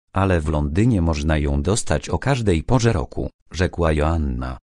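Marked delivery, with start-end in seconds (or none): none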